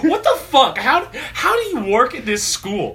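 high, loud, laughing disbelief; retold outrage. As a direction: re-enact protesting in shock. Retelling outraged reply to teacher.

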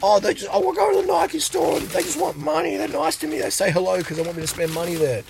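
high whiny mock complainer; mock whiny shopper. Mocking people who think stores just want their money, high whiny. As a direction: squeak it out like a spoiled shopper. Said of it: "It's just".

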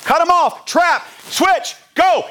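high, loud courtside shouts; animated coach. Acted. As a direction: bark plays out to the team. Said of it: "my animation while I'm coaching".

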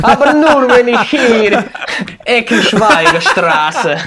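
loud, high, hammed-up Russian-like accent; slow, drawn-out Romanian-Russian accent on the place name; mock Romanian-Russian accent. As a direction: join the joke in a big fake Eastern European accent. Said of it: "romanisch-russischen".

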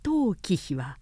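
ordinary delivery, nothing marked

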